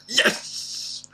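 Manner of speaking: high, hissed victory cry; gleeful dad cheering on his boy; triumphant cheer. Retold or imitated. Dad celebrates son resisting mother.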